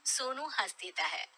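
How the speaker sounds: plain reading voice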